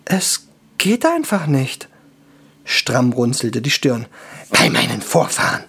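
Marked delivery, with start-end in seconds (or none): 0.1-1.9 slow, high, small elf voice; lightly stunned high elf; helpless elf. Acted. Stunned elf cannot log off. 4.5-5.6 hoarse, gruff dwarf exclamation; rough dwarf muttered swearing; dwarf oath. Acted. Frowning dwarf swears by ancestors.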